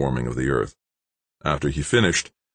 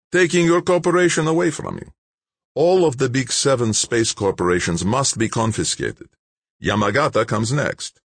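higher, louder, self-satisfied bureaucrat; smug official Malik; 9 semitones higher, 4 dB louder than usual. A narrator's reading. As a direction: announce the shutdown coolly, pleased with yourself. Malik's voice held.

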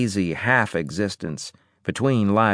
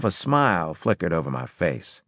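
ordinary delivery, nothing marked

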